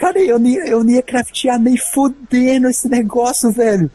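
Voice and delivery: high-pitched, exasperated rant; hammed-up outraged refusal; mock indignation. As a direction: protest in comic disbelief. Acting out refusal to give it up.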